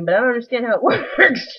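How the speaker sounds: usual speaking voice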